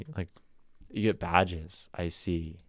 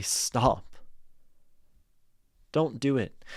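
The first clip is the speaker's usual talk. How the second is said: raised, higher-pitched insistent warning; urgent plea; 5 semitones higher than usual. Acted. Poem's speaker trying to stop the parents marrying, urgent plea. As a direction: plead with them, building from a single word.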